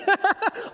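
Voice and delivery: high amused laugh; burst of laughter. Retold or imitated. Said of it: "I'm laughing".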